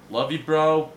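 a hearty shout to a friend; loud shout-out. Real, not acted. Friend shouting out his buddy on the podcast, loud. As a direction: call "love you, bro" loud and high.

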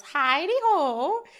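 comic imitated holler hello; drawn-out greeting call. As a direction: call it out long and singsong. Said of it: "the former of which goes".

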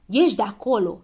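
loud, stern command; brisk storybook scolding; snapped order. A narrator's reading. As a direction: order someone out sharply. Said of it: "se răsti la el Știe Tot".

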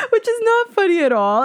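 high, breaking into a laugh; laughing disapproval. Real, not acted. Telling listeners dad's fake faint wasn't funny, laughing. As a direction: scold while giggling.

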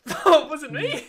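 loud, high-pitched laughing protest; high, amused, indignant denial. Real, not acted. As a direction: protest your innocence through laughter. Laughing denial of friend's accusation.

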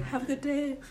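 high, soft flirty cashier; breathy sweet "have a good day". Retold or imitated. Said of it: "she's like".

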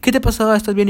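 high-pitched imitation of a concerned mom; lightly acted motherly worry; worried mother. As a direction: check on your child in mock alarm. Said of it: "mi mamá, toda preocupada".

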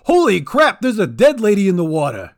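high, loud startled goblin yell; shocked shout. A narrator's reading. Goblin spots a body.

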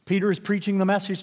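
ordinary delivery

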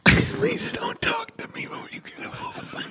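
whispered, slow fake despair; mock whisper. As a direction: breathe it out like you're too broken to speak. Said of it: "I would say".

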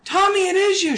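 put-on high, loud thrilled girl's voice; excited girl. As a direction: squeal with joy at seeing him. Girl overjoyed to see Tommy.

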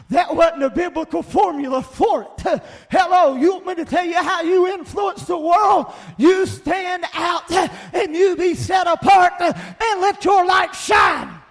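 loud, high, driving sermon shout; preach at full force. Real, not acted. Preacher telling the congregation to stand out, full-force shouting. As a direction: thunder it out, stretching the final words.

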